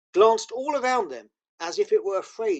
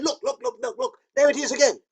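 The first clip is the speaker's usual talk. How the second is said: high, eager repeated "look"; excited; 8 semitones higher than usual. Acted. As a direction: tumble the words out pointing. Excited sighting of beaver.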